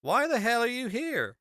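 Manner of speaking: high, loud incredulous local; hammed-up bewildered country local; baffled American local. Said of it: "they're like".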